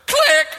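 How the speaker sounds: loud spoken "Click!" for a phone hanging up; mouth click. Retold or imitated. Barista hanging up on the caller, a sharp loud click. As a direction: snap the word out, like slamming down the receiver.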